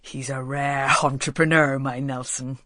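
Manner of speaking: lightly voiced proud old father; proud old Walter. A narrator's reading. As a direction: boast about his son like a fond old villager. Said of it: "explained Walter proudly".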